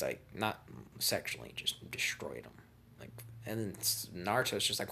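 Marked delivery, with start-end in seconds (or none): none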